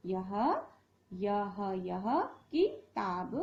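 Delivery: यह किताब drawn out slowly; slow model phrase. Shown as a demonstration. Teacher models यह किताब slowly to the class. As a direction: spell out यह किताब slowly for children.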